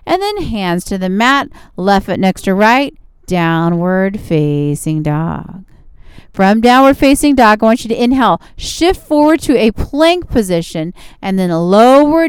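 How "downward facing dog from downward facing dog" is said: ordinary talk, nothing marked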